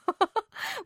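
short high giggle; embarrassed laugh. Real, not acted. Host laughs at her own odd pronunciation, giggling. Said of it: "私の発音がちょっとね、変ですけど".

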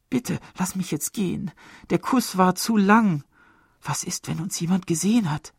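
high coquettish woman's voice. A narrator's reading. Woman teasing her lover, coquettish and high. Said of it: "Ihre Stimme klang ziemlich kokett".

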